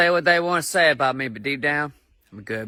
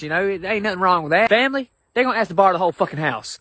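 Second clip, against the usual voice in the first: normal talking voice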